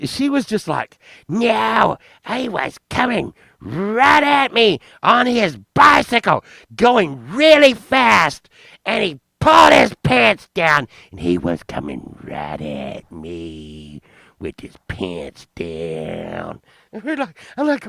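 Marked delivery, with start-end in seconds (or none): 1.3-10.9 high, wailing imitation of an outraged woman; mock distraught accuser. Woman reports the bicycle mooning to police, mock distraught. 11.1-16.6 very low, slow, drawn-out monotone; mock traumatized accuser. Woman finishes telling police what she saw, mock traumatized.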